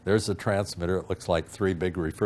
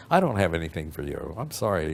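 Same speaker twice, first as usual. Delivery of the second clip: ordinary delivery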